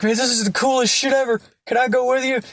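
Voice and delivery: high-pitched, eager gushing; excited fanboy. Acted. Francis begging to go along with Dante, high eager excitement. Francis thrilled, begs to join.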